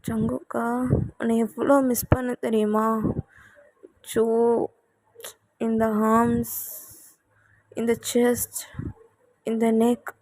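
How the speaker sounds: tender longing. Acted. Wife tells husband she missed him.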